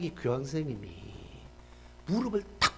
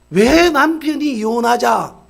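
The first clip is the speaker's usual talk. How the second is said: much louder, slower, stressed 왜; loud question; 6 semitones higher, 14 dB louder, slower than usual. Retold or imitated. Preacher asks the congregation why the husband wanted divorce, loudly.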